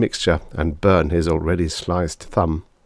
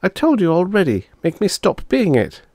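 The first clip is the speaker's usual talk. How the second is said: put-on girl turned chicken, complaining; 12 semitones higher than usual. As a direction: whine at your brother like a cross little girl.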